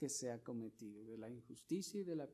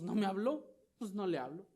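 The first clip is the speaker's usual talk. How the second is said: mock spiteful retort; 6 semitones higher, 8 dB louder, faster than usual. Quoting a spiteful reply.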